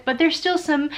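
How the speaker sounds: ordinary talk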